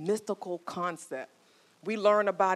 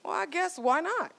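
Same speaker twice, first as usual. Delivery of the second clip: husband's reply; 10 semitones higher than usual. Retold or imitated. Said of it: "And he goes".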